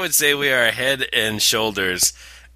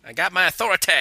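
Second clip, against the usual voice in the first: higher bratty cartoon kid; Cartman impression; 6 semitones higher, much faster than usual. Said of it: "Like fucking Cartman".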